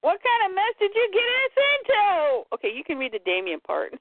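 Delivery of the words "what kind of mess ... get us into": higher character voice. Acted. Reading a comic character's line.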